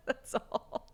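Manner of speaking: high giggle into the words; laughing. Real, not acted. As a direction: finish through leftover laughter. Laughing over her own confusion.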